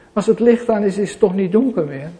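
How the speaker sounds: ordinary delivery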